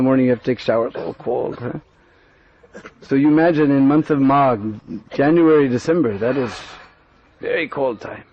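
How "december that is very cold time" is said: ordinary delivery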